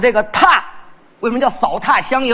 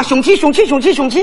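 raised Shaanxi dialect chant; Shaanxi accent; 5 semitones higher than usual. Acted. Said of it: "不是陕西人说话吗？".

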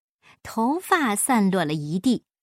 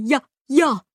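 louder, insistent cry; raised voice, short bursts; loud shout; 5 dB louder than usual. A narrator's reading. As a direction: holler it out impulsively. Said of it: "巴依老爷大声地喊".

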